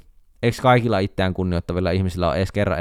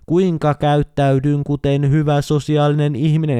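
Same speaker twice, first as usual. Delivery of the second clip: mock tutorial voice; 4 semitones higher than usual.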